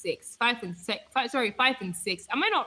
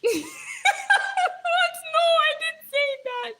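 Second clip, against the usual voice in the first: very high squealed denial; laughing disbelief; much slower than usual. Real, not acted. Streamer denies what viewers heard, high laughing squeal. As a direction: squeal the protest through laughter.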